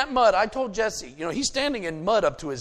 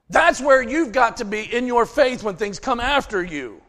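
ordinary talk, nothing marked

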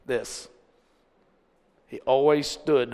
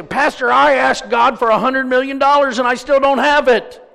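loud, high protesting voice; mock complaint; 9 semitones higher, 8 dB louder than usual. Preacher mimicking church member complaining to pastor, mock indignation. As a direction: imitate an indignant grumbler, voice raised.

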